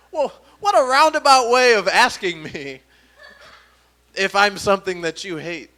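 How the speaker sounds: high, loud, amused disbelief; incredulous comeback. Retold or imitated. Comedian retells answering the man who asked, incredulous. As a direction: marvel aloud, half laughing. Indignant comeback in retold exchange.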